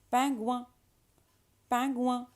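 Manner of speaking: "pingouin" as pan-gwan with nasal vowels; little w sound. Shown as a demonstration. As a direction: model "pingouin" high and loud, with a w after g. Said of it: "a little w sound".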